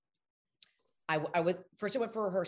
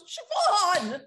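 higher loud shout of her name, laughing; Lars shouting; 10 semitones higher, 8 dB louder than usual. Retold or imitated. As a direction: yell to catch her peeking. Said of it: "he goes".

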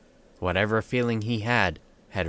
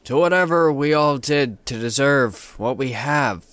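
loud tipsy toast, bottle raised; 6 semitones higher, 6 dB louder than usual. Acted. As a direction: call it out to the group, a bit drunk. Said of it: "spoke aloud".

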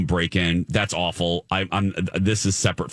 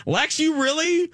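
stunned she could be bored; shocked disbelief. Real, not acted. Host to cohost, squeaky shocked disbelief. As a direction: pitch leaps way up in disbelief.

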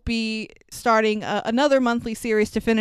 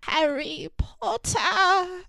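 dying Dobby; 8 semitones higher, much slower than usual. Retold or imitated. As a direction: breathe it out like a dying elf. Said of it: "his last lines ... lying in Harry's arms".

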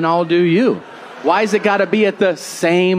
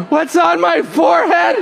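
much higher shouted cry of alarm; muffled shout; 11 semitones higher than usual. Retold or imitated. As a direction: yell up from underneath in panic. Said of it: "I hear".

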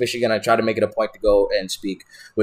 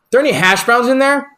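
higher and louder, hoping; anxious question; 11 semitones higher, 5 dB louder than usual. Retold or imitated. Asking Tom about the hash browns, high and hopeful.

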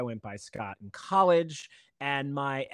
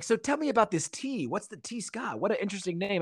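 higher, softer imitation of an inquirer; curious supervisor; 7 semitones higher, 4 dB quieter than usual. Music supervisor asks about the name, higher curious mimicry. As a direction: lightly voice an intrigued questioner, pitched up.

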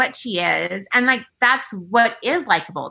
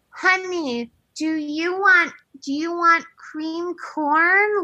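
put-on higher, slow sing-song voice to a child; sweet baby talk; 6 semitones higher, much slower than usual. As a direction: coo it like talking to a toddler. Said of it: "talking to, like, a, literally a child".

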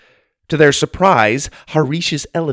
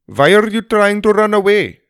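put-on high, loud Indian-accented character voice; 9 semitones higher, 5 dB louder than usual. A narrator's reading. Elephant rider calling to fleeing family, Indian-accented call.

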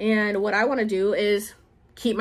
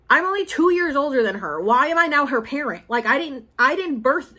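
ordinary delivery

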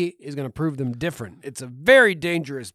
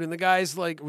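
ordinary delivery

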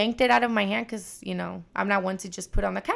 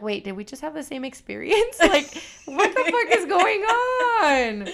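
laughing disbelief; 7 semitones higher, 3 dB louder than usual. Retold or imitated. Acting own bewildered reaction.

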